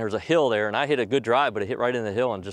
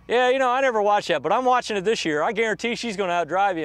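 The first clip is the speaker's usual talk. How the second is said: high, raised voice of a needling teammate; teasing teammate; 11 semitones higher, 3 dB louder than usual. Retold or imitated. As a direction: imitate a buddy piling on from afar. Furyk needles him from table end.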